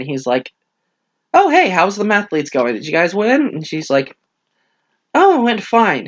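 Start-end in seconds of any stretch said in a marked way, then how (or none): 1.3-3.4 higher-pitched breezy hello; mock-casual catching up on the phone; casual phone greeting. 5.1-6.1 high-pitched, falling casual answer; breezy phone reply. Retold or imitated.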